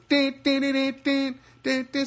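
high, rhythmic car-horn beeps; mimicked horn pattern; imitated car honking. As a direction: beep out a car horn on one high note. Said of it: "I start honking the horn".

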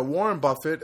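usual speaking voice

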